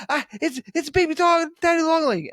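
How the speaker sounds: high, stammering mock panic; imitated freak-out. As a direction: mimic a panicked partner squealing. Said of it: "loses her shit".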